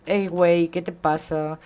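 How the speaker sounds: put-on drawn-out joking Mexican accent; brief comic imitation of Mexican speech; mock Mexican accent. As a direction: put on a playful Mexican lilt. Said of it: "Acento mexicano".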